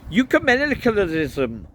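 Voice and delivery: high, loud Welsh priest voice; indignant Welsh delivery; Welsh accent. A narrator's reading. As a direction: belt it out in a Welsh accent, falling at the end. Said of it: "trying to do a Welsh accent".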